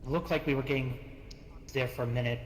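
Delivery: usual speaking voice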